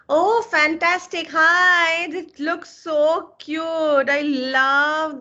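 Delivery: light up as the student appears; excited greeting. Real, not acted. Student finally appears on camera.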